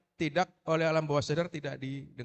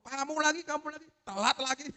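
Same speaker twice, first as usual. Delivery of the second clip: high-pitched mock nagging; exasperated teacher; 8 semitones higher than usual. Teacher to the same late pupil, exasperated. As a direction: nag like a fed-up teacher. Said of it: "Jangan dibilang telat mulu".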